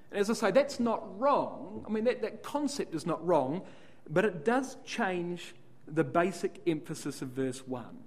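usual speaking voice

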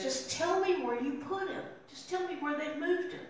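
mildly desperate begging, kept small; pleading. Acted. Mary begs to know where the body is, pleading.

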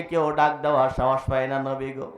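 ordinary talk

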